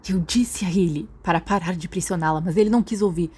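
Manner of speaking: ordinary delivery, nothing marked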